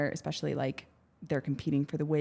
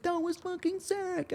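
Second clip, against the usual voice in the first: much higher, slow, stoked bro voice; mock surfer dude; 14 semitones higher than usual. Boyfriend cheering after her near drowning, hammed-up stoked surfer dude. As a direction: ham up the clueless thrilled boyfriend. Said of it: "came out and was like".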